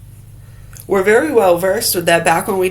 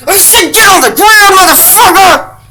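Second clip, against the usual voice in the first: hammed-up screaming cop, much higher and louder; furious cop shout; 12 semitones higher, 9 dB louder than usual. Officer screaming at a suspect to get on the ground. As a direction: scream the order at the top of your lungs.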